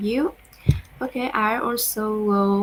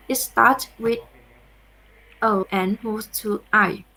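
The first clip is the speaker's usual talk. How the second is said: normal talking voice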